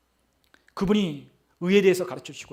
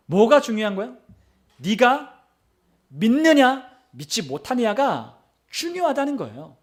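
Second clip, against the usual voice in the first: loud, high, punched phrases; forceful challenge to the congregation; emphatic preaching; 5 semitones higher, 5 dB louder, slower than usual. Real, not acted. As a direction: drive the point home, building louder. Preacher drives home key point.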